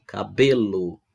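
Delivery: "Cabelo" with a strong, drawn-out "be"; stress on BE. Shown as a demonstration. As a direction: lean into the middle "be" of "Cabelo". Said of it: "ela tem a penúltima sílaba mais forte". ("Cabelo" com ênfase na penúltima sílaba, "be")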